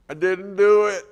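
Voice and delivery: put-on high, loud little-kid voice; small child confessing. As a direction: mimic a young child owning up to Dad.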